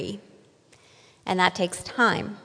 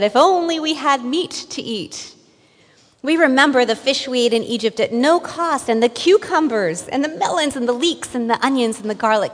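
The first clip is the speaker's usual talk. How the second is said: louder and higher, hammed-up wail over lost food; mock wailing; 4 semitones higher, 6 dB louder than usual. Israelites long for Egypt's food, loud mock wailing. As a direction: wail the list of Egyptian foods like a spoiled crowd. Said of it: "started wailing and said".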